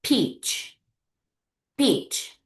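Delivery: PEACH as one single beat; one syllable. Shown as a demonstration. Teacher models a one-clap word for the class, one syllable. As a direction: say PEACH in one clap's worth of sound. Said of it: "One syllables. One clap".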